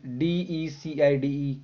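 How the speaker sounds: letters of decide said slowly, one at a time; spelling out letters. Shown as a demonstration. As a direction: spell each letter for the children. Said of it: "डिसाइड की हो जाएगी". (अक्षर-अक्षर धीमे बोलना)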